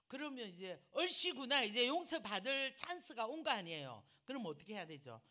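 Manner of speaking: loud, high and gleeful; mock glee. Mocking the brother's chance, gleeful. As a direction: play the eager delight.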